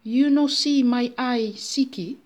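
hammed-up American visitor; fake American. A narrator's reading. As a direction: explain slowly in broken English. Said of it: "een heuse Amerikaan".

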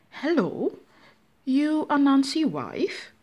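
high, slow put-on American character; hammed-up American visitor voice; fake American. A narrator's reading. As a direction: pose as an American with broken English. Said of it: "een heuse Amerikaan".

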